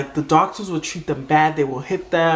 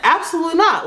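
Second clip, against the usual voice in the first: high-pitched, indignant boss quote; scandalized veto, acted out; retold emphatic refusal; 11 semitones higher than usual. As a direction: imitate a boss flatly refusing, pitch shooting up. Boss's retold shocked refusal.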